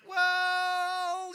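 loud, high, drawn-out hesitation; hedging excuse. Acted. Challenged believer stalling before an excuse, high drawn-out hedging. Acting someone making excuses.

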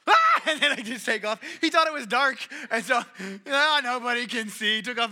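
laughing. Real, not acted. Laughing at his own story.

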